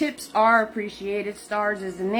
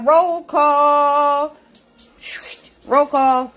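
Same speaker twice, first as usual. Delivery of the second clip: announcer roll call; 5 semitones higher, 5 dB louder, much slower than usual. Acted.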